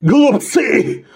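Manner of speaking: high, strained wheezed outcry; hoarse, choking. A narrator's reading. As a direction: force it out through a choking cough. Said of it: "прохрипел он, перхая и давясь".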